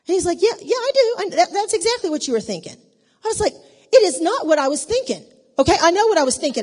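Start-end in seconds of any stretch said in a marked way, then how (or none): 0.4-2.7 high, loud, quick-fire retort; pushy acted-out comeback, pitched up; insistent accusation. Acting husband's insistent accusation. 3.9-6.6 loud, high protest; acted-out indignant comeback, punching each word; indignant denial. Acted marital argument, indignant denial.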